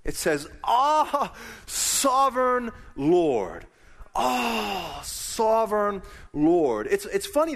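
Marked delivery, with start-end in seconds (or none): 0.6-6.9 awed cry. Acted. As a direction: cry out in pained wonder to the Lord. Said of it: "this word awe in the Hebrew is a word meaning pain and surprise".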